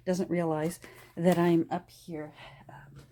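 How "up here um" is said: hushed whisper so her husband won't hear. Real, not acted. Whispering so her husband downstairs won't hear. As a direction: drop to a secret whisper.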